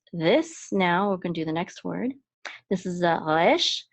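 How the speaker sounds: ordinary talk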